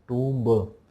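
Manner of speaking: टूम्ब with the final b sounded; टूम्ब with an added b; wrong pronunciation. Shown as a demonstration. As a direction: say टूम्ब ending in an audible "buh". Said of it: "टूम्ब नहीं बोलेंगे".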